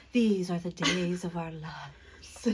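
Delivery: slow, drawn-out mock soap intro; soap opera announcer. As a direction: intone it like a melodramatic title voiceover. Mimicking soap opera title voiceover.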